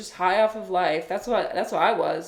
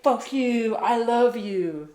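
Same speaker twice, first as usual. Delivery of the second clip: higher, slow fuck you I love you; mock intense hippie; 5 semitones higher, much slower than usual. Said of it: "He was like".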